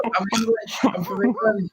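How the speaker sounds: ordinary talk, nothing marked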